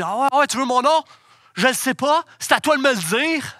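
shouted cheeky comeback, high and loud; réplique insolente criée. Retold or imitated. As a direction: fire back at the teacher, high and loud. Étudiant insolent répond au professeur. (réplique insolente criée, aiguë et forte)

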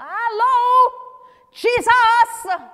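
high, loud hollered call; shouting. Acted. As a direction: yell to be heard over a crowd. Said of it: "but he shouted even more".

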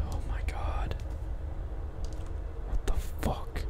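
hushed, unvoiced disbelief; shaken whisper. Real, not acted. Player just insta-killed in a scary game, shaken whisper. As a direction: whisper it under the breath.

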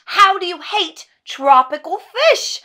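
shouted, high, drawn-out disbelief; frantic exasperation. Acted. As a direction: cry it out at wit's end. Rant peaks.

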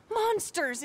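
bitter outburst. Acted. Woman telling a stranger about her family's enemies, bitter outburst. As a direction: spit the word out in revulsion. Repeated word, louder and higher.

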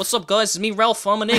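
cheerful Kiwi greeting, loud; Kiwi accent. Pretend. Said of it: "your impression of a Kiwi accent".

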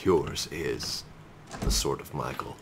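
low, soft ominous prophecy; mock prophet. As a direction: intone it like a doom-laden oracle.

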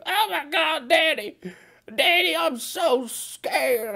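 high, loud mock child's wail; scared little boy. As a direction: wail up high like a terrified kid calling Daddy. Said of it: "he be like".

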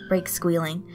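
usual speaking voice

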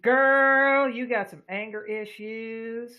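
drawling country voice; country accent. Acted. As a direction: say it with a country twang. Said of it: "Why that came out as country".

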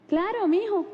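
high, sweet mom voice; imitating a falsely obliging mother; mock-agreeable mom. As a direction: agree in a lifted, put-on voice. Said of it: "Y le dice mi mamá".

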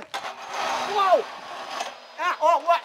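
high, loud startled yell of delight; screaming through the sudden drop; thrilled scream. Real, not acted. Second go on the ride.

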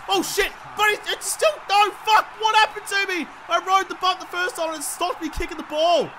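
high, loud protest at the game; exasperated outburst. Real, not acted. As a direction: cry out in real disbelief and frustration. Frustrated outburst at a failed play.

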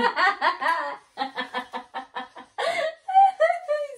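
long, high helpless laughter; laughing hard. Real, not acted. Player cracking up at the guesser, laughing hard. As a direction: dissolve into high giggles.

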